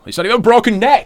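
high, loud incredulous exclamation; exasperated outburst. Real, not acted. Co-host protesting the neck injury storyline, exasperated outburst. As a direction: burst out loud and high-pitched in disbelief.

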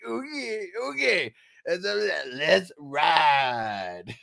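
garbled, thick put-on accent, stretched ending; imitating unintelligible trail guide. Retelling Kevin Hart's horse riding bit, mock garbled guide voice. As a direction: mimic a guide nobody can understand. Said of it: "you couldn't understand".

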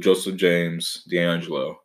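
normal talking voice

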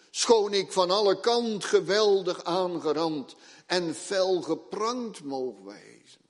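preacher declaiming a psalm line fearlessly; declaimed psalm verse. Acted. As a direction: proclaim the verse with bold courage. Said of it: "wat we de dichter wel eens horen zingen".

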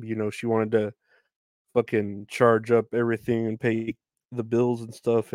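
normal talking voice

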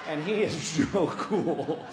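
ordinary talk, nothing marked

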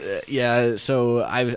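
a light take on the coach's drawl; lightly voiced coach, easygoing; Ishmael impression. Imitating the martial arts coach Ishmael, lightly drawled. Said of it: "Ishmael impression".

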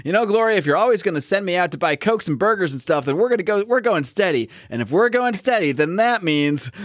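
put-on high-pitched boyfriend rehearsing his speech; Tommy. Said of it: "Tommy is practicing his speech to Glory".

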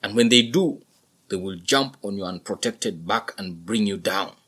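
loud, patient father bull; explaining buffalo father. A narrator's reading. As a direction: explain it loudly to your son. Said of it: "The dad smiled and explained".

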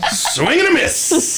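high, slow announcer call. Pretend. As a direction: call it like a ballgame. Mock commentary.

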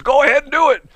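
high, loud call from the lane; calling out over traffic. Retold or imitated. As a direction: shout it across the road to him.

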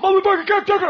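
very high, loud burst of swearing; imitated startling outburst. Neighbor bursts out while asking a price, imitated outburst. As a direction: mimic a tic exploding mid-conversation. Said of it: "he made me jump when he did it".

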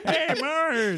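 high goofy cartoon dad; lightly voiced cartoon dad; Homer Simpson voice. Homer impression.

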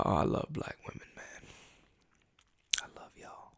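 soft breathy whisper trailing away. Real, not acted. Podcast host suddenly whispering to listeners, hushed. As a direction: whisper it to the mic. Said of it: "Why am I whispering?".

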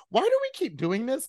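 high, incredulous whine on "Why do we keep doing this?"; exasperated mock question. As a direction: sound fed up and baffled. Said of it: "you're like".

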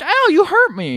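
high-pitched imitation of a hurt boy; mock-hurt kid. Retelling the boy stabbed in the finger, mock-hurt kid voice. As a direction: whine it high like a caricatured kid.

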